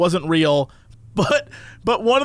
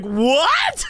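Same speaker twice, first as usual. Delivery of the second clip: a hammed-up what, raised high; mock disbelief; 5 semitones higher than usual. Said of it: "it's like".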